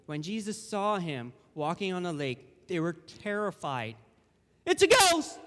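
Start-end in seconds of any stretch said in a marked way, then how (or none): 4.6-5.4 high, loud yelp of terror; acted frightened shout; fearful cry. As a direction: cry out as if seeing a ghost. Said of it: "and cried out in fear".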